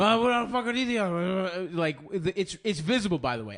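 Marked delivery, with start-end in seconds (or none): 0.0-1.0 panicked high blurt. Retold or imitated. Friend caught by the waiter, blurting a high panicked question. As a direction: pitch it up, caught off guard and rattled. Acting out Nick's panicked quote.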